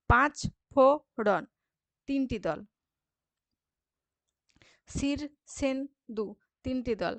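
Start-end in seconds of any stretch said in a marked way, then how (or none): 0.1-1.4 পাঁচ ফোড়ন slow, loud, in three beats; split into syllables. Shown as a demonstration. Teacher counting syllables, slow loud beats. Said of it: "দল বিশ্লেষণ করে". 4.9-6.3 Shirshendu slowly as শীর, ষেন, দু; split into syllables. Shown as a demonstration. Teacher counting syllables, slow and separated. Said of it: "দল বিশ্লেষণ করে".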